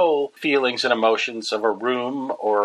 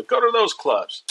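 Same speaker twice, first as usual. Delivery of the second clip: higher Rodney giving advice; 12 semitones higher than usual. Retold or imitated. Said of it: "quoting Rodney".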